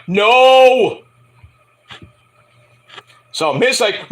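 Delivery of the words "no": flat refusal. Retold or imitated. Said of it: "I'm like".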